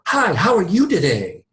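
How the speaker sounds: bright, high, pushy salesman greeting; hyper enthusiastic salesman. Retold or imitated. Agent mocking a cheesy salesman answering the phone, over-enthusiastic. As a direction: chirp it like an overeager salesman. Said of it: "hyper enthusiastic, super salesman mentality going".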